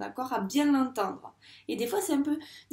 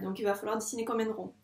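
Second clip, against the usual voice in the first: normal talking voice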